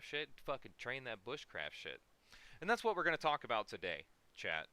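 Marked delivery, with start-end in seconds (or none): 2.6-4.7 excited. Real, not acted. Hyped stream intro to chat.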